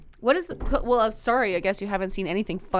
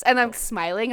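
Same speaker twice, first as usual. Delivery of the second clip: ordinary talk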